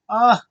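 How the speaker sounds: loud, high "ah"; short high burst of feeling; excited cry. Real, not acted. Reviewer relishing the story, loud high cry.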